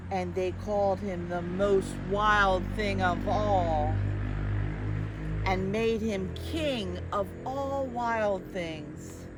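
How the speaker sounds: ordinary delivery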